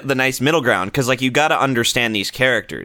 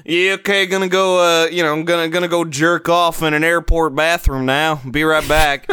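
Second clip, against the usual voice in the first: [name]. Acted. Actor leaving the booth, lightly put-on voice. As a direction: casually sign off as the voice actor. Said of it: "this guy named [name]".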